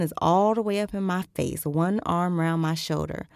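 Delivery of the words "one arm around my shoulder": ordinary delivery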